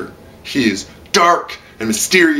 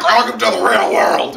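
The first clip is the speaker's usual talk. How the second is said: mock Rat; 9 semitones higher than usual. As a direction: throw it at him like a taunt. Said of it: "Rat's like".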